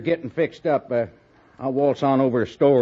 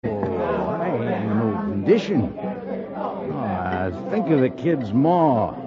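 put-on drunk. Drunk cowhand in the saloon.

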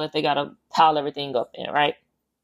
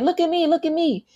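put-on much higher, sing-song little girl's voice; child calling out; 10 semitones higher than usual. Little girl on the roof calling down to mom, high and playful.

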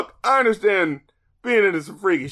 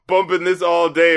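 ordinary delivery

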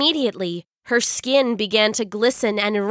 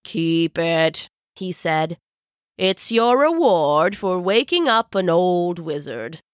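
put-on kind old wizard; 3 semitones lower than usual. Wizard kindly.

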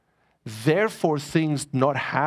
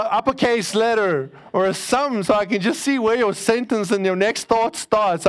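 ordinary talk

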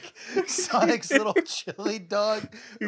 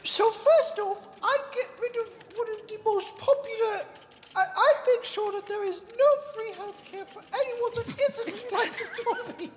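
Dobby voice; 13 semitones higher than usual. Acted. Dobby answers debate question.

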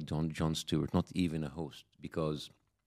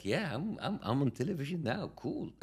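mock casual cool; 8 semitones higher, 4 dB quieter than usual. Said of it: "I was like".

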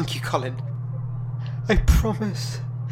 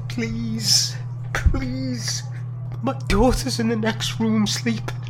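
pleading; 12 semitones higher, much slower than usual. Acted. Simon begging for his life.

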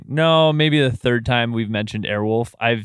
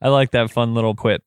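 ordinary talk, nothing marked

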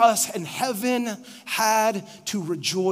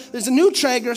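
normal talking voice

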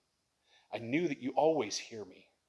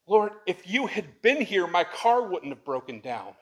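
higher and louder, pleading and building; anguished cry to God; 6 semitones higher, 8 dB louder than usual. Acted. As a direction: cry out the repeated lament as if grieving. Preacher voicing Mary's anguished cry.

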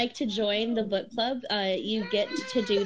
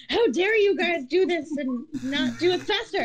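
laughing; 8 semitones higher than usual. Real, not acted. Mock outrage amid laughter.

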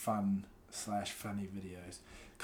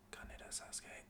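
names under the breath, unvoiced; whispering; 13 dB quieter than usual. Real, not acted. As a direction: mutter them quietly to yourself.

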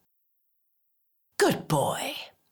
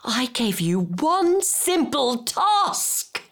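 exasperated scolding; 4 semitones higher than usual. Acted. Berating someone useless at one simple task, exasperated.

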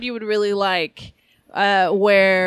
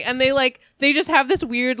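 usual speaking voice

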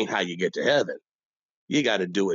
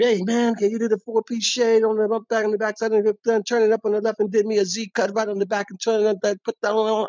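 put-on higher, louder kid rattling off a fancy order; young customer; 12 semitones higher, 3 dB louder than usual. Youngin ordering a fancy haircut in a higher louder voice. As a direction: show off the new lingo.

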